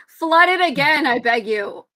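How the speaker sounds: ordinary talk, nothing marked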